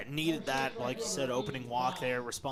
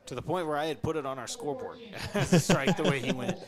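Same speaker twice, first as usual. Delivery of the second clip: laughing; 2 semitones higher, 7 dB louder than usual. Real, not acted. Laughing about umpire fake-out.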